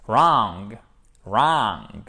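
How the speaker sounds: "wrong" with a smiling, drawn-out vowel; said through a smile. Shown as a demonstration. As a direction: smile while saying a long, low "wrong". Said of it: "comme si vous étiez en train de sourire". (« wrong » dit en souriant, voyelle allongée)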